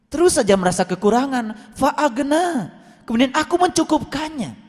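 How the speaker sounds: high, emphatic proclamation; impassioned preaching. Real, not acted. Preacher voicing Allah's promise to congregation, emphatic. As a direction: declare it high and firmly. Preacher drives the verse home.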